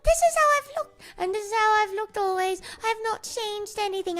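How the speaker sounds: defensive high-pitched insisting; high indignant insistence. Real, not acted. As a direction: squeak out the protest. Insisting she always looked this way.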